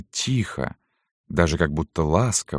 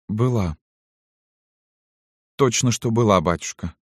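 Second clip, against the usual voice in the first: put-on higher, understated girl's confession; trembling, guttural voice; 4 semitones higher than usual. A narrator's reading. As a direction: admit it to your father with a tremble. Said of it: "заговорила девушка дрожащим, гортанным голосом". (дрожащим, гортанным голосом)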